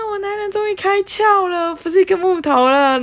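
high thrilled gush; thrilled brag about boyfriend finally getting it; gleeful brag. Retold or imitated. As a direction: crow with delight, high.

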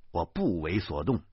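ordinary delivery

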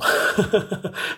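high unvoiced laugh; burst of laughter. Real, not acted. Laughing at her own joke, unvoiced laugh.

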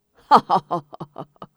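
loud triumphant cackle; boastful belly laugh; hearty laugh. A narrator's reading. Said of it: "哈哈大笑". (得意地哈哈大笑)

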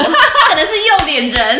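high, loud laugh in the voice; giggling out the punchline. Real, not acted. Joking to her co-host about the teacher, laughing.

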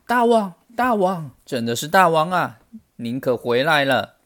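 high excited cry, settling toward the end; excited greeting. A narrator's reading. Monkeys crowd round their returned king, crying out excitedly. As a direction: call out to a long-awaited king.

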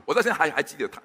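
normal talking voice

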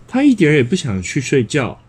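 ordinary delivery

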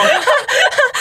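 shrieking laughter; high burst of laughter. Real, not acted. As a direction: crack up, high. Laugh at story.

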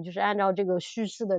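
ordinary delivery

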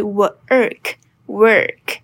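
very slowly, work with a long er; curled-r work. Shown as a demonstration. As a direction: work 拖长卷舌 er，很慢. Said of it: "直接卷舌，er". (超慢速卷舌念 work)

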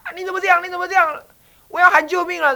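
high, loud, frightened pleading; a scared girl about to scream for help; shrill panicked cries behind the door; girl crying for help. Retold or imitated. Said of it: "就听到他".

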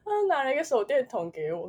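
a touch of real tears while laughing it off; tearful. Real, not acted. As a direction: say it choked up but amused. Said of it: "很好笑".

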